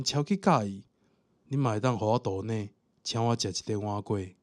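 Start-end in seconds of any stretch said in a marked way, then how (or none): none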